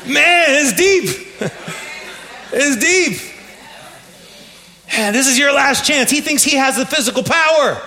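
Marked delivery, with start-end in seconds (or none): 0.1-3.2 laughing. Real, not acted. Pastor laughing at the film line, high and amused. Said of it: "laughing at the clip". 4.9-6.1 mock villain threat. Pastor mimicking the film villain, high and mocking. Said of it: "quoting the film villain".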